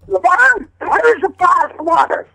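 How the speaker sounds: high, loud, slow rough mountain-woman call. Retold or imitated. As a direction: call Levon like a hill-country ma. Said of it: "I used that voice".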